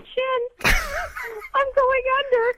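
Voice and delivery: high, panicked pretend sinking; sinking in quicksand. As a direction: play-act drowning in quicksand, rising fear. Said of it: "can we role play some quicksand real quick".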